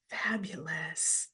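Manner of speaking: slow breathy whisper of fabulous. Real, not acted. Host savouring a chat answer, slow whisper of fabulous.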